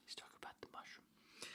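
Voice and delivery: quick unvoiced whisper; whispered aside. Real, not acted. Reader whispering an aside about the mushroom.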